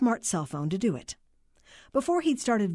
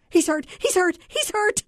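high, loud blubbering cries, climbing with each one; 12 semitones higher, 6 dB louder than usual. A narrator's reading. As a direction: wail it, beside yourself with distress. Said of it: "the voice blubbered".

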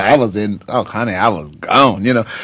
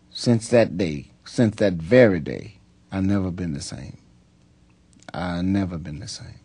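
dropping to a soft, quiet repeat; hushed reflection; 5 semitones lower, 6 dB quieter than usual. Real, not acted. Softly telling the host he's never been the same. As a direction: let it fade down as you remember.

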